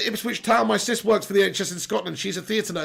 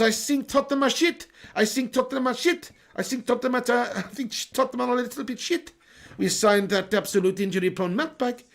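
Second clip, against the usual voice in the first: lightly put-on Portuguese accent; mock Mourinho. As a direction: read the chat as Mourinho. Reading Mourinho chat in voice.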